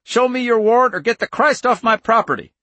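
very high, loud, cracking yell. A narrator's reading. Farmer demands a warrant from agents, high and cracking. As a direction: yell it at the top of the range. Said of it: "His voice was high, cracking".

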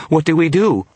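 very high, loud terrified cry; shaking, panicked voice. A narrator's reading. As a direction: wail it in full panic. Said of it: "His voice was shaking".

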